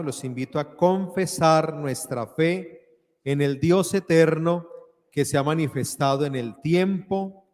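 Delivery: ordinary talk, nothing marked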